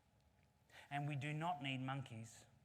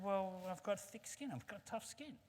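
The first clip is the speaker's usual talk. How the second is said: lightly voiced rhino, higher pitch; rhino character; 6 semitones higher than usual. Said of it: "He said".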